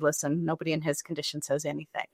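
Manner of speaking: softly retold nurse's aside; quiet word to the family; confidential aside. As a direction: take them aside, voice dropped low in volume. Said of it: "She kinda sidelined me and my mom".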